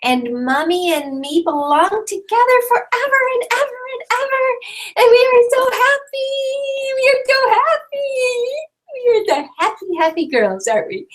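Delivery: put-on high, sing-song little girl voice; speaking for the baby. Mother voicing her daughter's news to the class, high and childlike. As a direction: voice the toddler's happy announcement.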